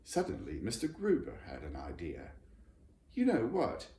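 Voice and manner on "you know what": high, loud eager question; excited old friend; excitedly. A narrator's reading. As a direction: burst out with the idea. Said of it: "he said excitedly".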